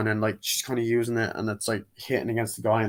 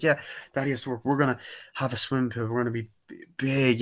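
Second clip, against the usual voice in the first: hurried father excuses; faster than usual. Retold or imitated. Father rushing excuses to kid.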